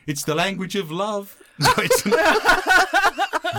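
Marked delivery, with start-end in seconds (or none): 1.6-3.5 laughing denial. Real, not acted.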